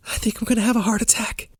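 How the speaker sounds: high, rushed, softened panic; quick squeaky alarm; panicky. A narrator's reading. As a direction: blurt it out in a fluster. Panicking after the police encounter.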